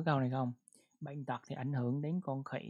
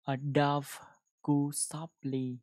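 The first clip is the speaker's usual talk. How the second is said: slow model reading; 5 dB louder, slower than usual. Shown as a demonstration. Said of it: "các bạn tự đọc nhé".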